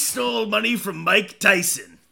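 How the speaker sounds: loud, high, drawn-out tale-telling; mock storyteller. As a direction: declaim it slowly like a legend. Continues mock storyteller bit.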